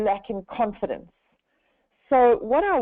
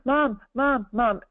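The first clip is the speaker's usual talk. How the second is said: panicked calling; 4 semitones higher, slower than usual. Retold or imitated. Daughter panicking again.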